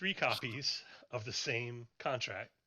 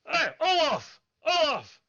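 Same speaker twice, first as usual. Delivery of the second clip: high, loud call across a busy workshop; much higher yelling voice; shouting for Olaf; 10 dB louder, slower than usual. Acted. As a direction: holler for a coworker to come. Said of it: "And he goes".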